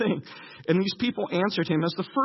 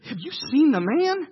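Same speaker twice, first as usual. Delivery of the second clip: awed fear; 6 semitones higher than usual. Retold or imitated. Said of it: "the men of Israel said".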